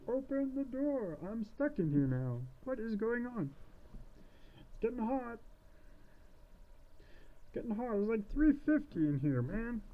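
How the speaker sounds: very high, drawn-out mock panic; hammed-up nervous witch voice. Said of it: "like, getting kinda nervous".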